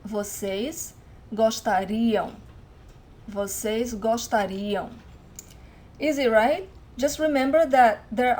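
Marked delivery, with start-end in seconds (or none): 0.1-2.3 slow "gostariam", stressed on "ri"; drawn-out teacher's "gostariam", weight on the i; stress on final I. Shown as a demonstration. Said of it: "stress of the word is always in this final I". 3.3-4.9 slow "gostariam", stressed on "ri"; drawn-out teacher's "gostariam", weight on the i; stress on final I. Shown as a demonstration. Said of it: "stress of the word is always in this final I".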